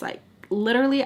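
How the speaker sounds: ordinary delivery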